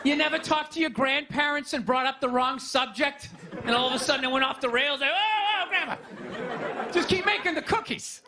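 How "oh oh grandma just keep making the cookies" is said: high, startled grandchild trying to interrupt; flustered, high-pitched protest; mock alarm. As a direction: cut grandma off in pretend panic.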